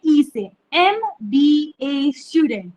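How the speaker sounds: एमबीए स्टूडेंट slow, एम said as em from an e sound; slow demo. Shown as a demonstration. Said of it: "pronounce किससे कर रहे हैं?". (एमबीए स्टूडेंट, ई से शुरू, धीरे)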